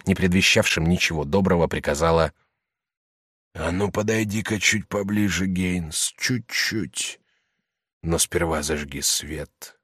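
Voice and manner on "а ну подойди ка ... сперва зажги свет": тихо и угрожающе, приглушённо; зловещий приглушённый приказ; menacing hush. A narrator's reading. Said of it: "наводящим ужас приглушённым голосом". (приглушённым голосом, с угрозой)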